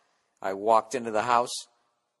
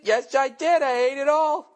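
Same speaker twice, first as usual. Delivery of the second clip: put-on high, louder sing-song fib of a child; mock little kid; 6 dB louder than usual. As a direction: play the kid lying about dinner. Kid voiced answering the mother.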